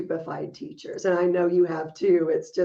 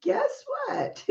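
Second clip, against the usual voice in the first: teasing voice; 5 dB quieter than usual. Pretend. Hospice calling with the news.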